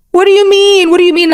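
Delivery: high-pitched, incredulous repeat; acted bafflement. Cashier reacting to a customer's walkout, baffled.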